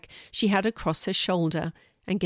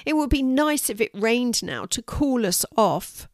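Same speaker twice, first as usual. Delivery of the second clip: higher, a touch of whining; mild whine; 6 semitones higher than usual. A narrator's reading. Boy wishing for rain, lightly whining. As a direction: let the complaint linger, lightly. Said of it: "groaned Louis".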